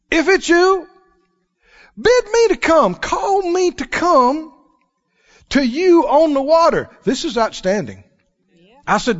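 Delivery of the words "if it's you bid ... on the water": high eager call to Jesus; Peter calling out. Acted. Peter calling from the boat to Jesus, high and eager. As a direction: call out boldly, drawing out call me to come. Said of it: "Peter spoke up and said".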